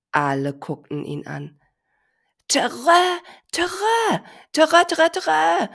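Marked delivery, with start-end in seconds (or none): 2.5-5.7 repeated high, loud trumpet calls; elephant trumpeting. Acted. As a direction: trumpet over and over like a happy little elephant. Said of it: "machte der kleine Elefant nochmal".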